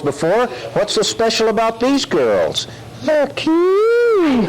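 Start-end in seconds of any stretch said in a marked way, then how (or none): 3.0-4.5 high giggly puppet. Acted. Dummy gushes about the girls, high giggly puppet voice. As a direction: coo it with a giggle.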